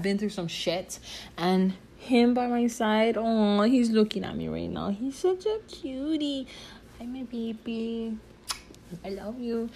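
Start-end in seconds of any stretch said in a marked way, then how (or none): 4.9-9.7 softer, a little higher, lightly cooing; cooing to puppy. Real, not acted. Owner softly cooing to her puppy, light baby talk. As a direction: talk sweetly to the dog looking at you.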